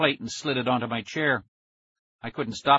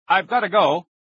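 raised, higher voice; calling over noise; 6 semitones higher, 8 dB louder than usual. A narrator's reading. Kid signing off to his screaming friend, loud call. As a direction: call it out loudly to be heard.

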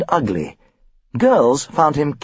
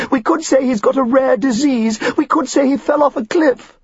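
much higher, hammed-up wail; comic wailing Mrs Elliot; wailing mother; 13 semitones higher than usual. A narrator's reading. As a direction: moan it like a melodramatic wife. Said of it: "Missus Elliot wailed".

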